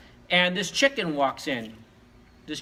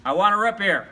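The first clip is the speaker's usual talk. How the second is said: raised voice, louder than normal talk; a little louder; 2 semitones higher, 5 dB louder than usual. Acted. Ignored dog asks the saloon manager, a little louder. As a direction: press the demand a bit louder. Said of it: "got a little louder and said".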